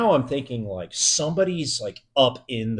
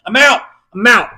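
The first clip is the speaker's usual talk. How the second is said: higher and louder, repeated and firm; loud exit call; 8 semitones higher, 10 dB louder than usual. Retold or imitated. Spooked guard fleeing the building, loud repeated I'm out. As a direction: bail out loudly.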